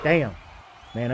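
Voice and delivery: usual speaking voice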